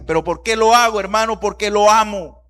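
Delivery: high, loud, driving preacher's delivery; impassioned preaching. Real, not acted. Preacher telling church why he corrects his son, impassioned. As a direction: pile up the reasons with rising fervor.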